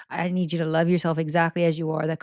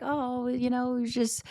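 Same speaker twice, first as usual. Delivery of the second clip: touched oh; 7 semitones higher than usual. Real, not acted. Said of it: "touched by friend's call".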